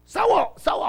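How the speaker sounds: high, loud cry of fight; mock urging yell. Pastor mimicking himself urging the girl to fight math, high yell. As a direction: egg her on with a sudden shout.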